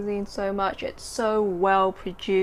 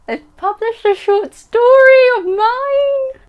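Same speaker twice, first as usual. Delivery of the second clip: high, loud, slow squeal of pure delight; excited squeal; 13 dB louder, slower than usual. Real, not acted. Announcing her story got published, a real excited squeal. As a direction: stretch it out in a thrilled squeal. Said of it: "I'm so excited by it".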